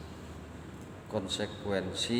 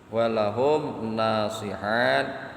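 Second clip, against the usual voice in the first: slow, louder intoned Arabic phrase; Arabic recitation; 6 dB louder, much slower than usual. Acted. Teacher quoting the kitab to the congregation, slow and intoned. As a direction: draw out the kitab words with weight.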